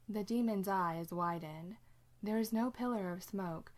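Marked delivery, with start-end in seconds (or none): none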